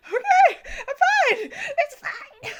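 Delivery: very high, squeaky near-crying voice; mock tearful. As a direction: insist through a hammed-up sob and laugh. Acts herself near tears, insisting fine.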